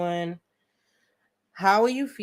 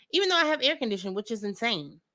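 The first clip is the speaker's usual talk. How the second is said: ordinary talk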